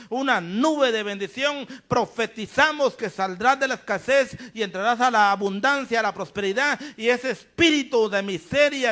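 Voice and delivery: prophetic declaration. Acted. Pastor prophesying abundance over the radio audience, declaring. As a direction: pronounce blessing like a prophet.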